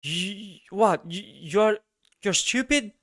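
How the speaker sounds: high incredulous mock voice, half laughing at him; mocking crypto bro. Mimicking the crypto guy who calls the speaker stupid, high and mocking. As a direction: scoff like a know-it-all who can't believe you didn't buy. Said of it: "he goes like".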